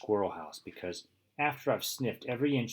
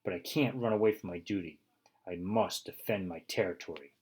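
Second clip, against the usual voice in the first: ordinary delivery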